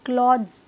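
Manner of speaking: क्लॉथ्स as "klodz", no th; one falling syllable, क्लॉथ्स with a buzzed dz ending; correct clothes pronunciation. Shown as a demonstration. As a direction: close क्लॉथ्स on D plus Z. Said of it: "द और ज का sound आएगा पीछे".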